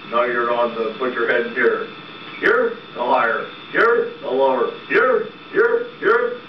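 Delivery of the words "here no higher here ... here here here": squeaky puppet voice. Acted. Puppet squeaking questions at hangman.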